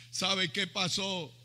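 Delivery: usual speaking voice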